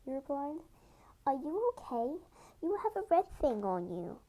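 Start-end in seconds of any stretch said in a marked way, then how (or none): 1.3-4.2 curious question. A narrator's reading. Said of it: "questioning curiosity".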